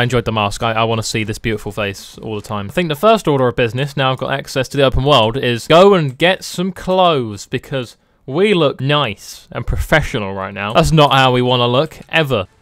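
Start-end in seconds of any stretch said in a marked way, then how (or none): none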